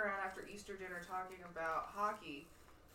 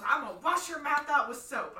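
scolding grandma; 5 semitones higher, 7 dB louder than usual. Retold or imitated. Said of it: "She goes".

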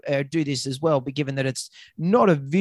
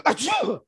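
sneezing; 11 semitones higher than usual. Retold or imitated. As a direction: sneeze it out, high and sharp. Owner sneezes on swab.

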